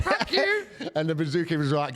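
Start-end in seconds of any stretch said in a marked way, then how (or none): 0.0-0.7 very high shrieking parrot; parrot squawk. Retold or imitated. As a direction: screech it like a rude parrot. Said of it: "the parrot would be like".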